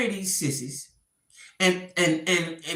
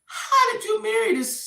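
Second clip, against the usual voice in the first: shocked disbelief. Retold or imitated. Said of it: "I was like".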